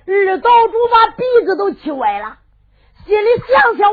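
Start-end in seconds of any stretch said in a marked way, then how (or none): none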